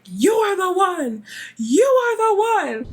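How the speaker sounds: slow, high, drawn-out prophecy; ominous pronouncement. Retold or imitated. Elder staring Lisa dead in the eyes, slow and ominous. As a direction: pronounce it like a fated oracle. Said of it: "he's like".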